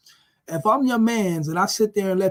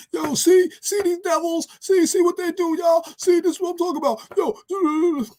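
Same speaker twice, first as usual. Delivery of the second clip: much higher, loud and fast outraged cry in a put-on voice; hammed-up outrage; 12 semitones higher, 5 dB louder than usual. Beaten protester calls out to the crowd, frantic mock outrage. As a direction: squeal it like a frantic agitator. Protester cries out during beating.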